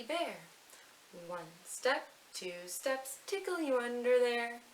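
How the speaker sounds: playful nursery rhyme. Acted. Teacher reciting a tickle rhyme to little ones, sing-song.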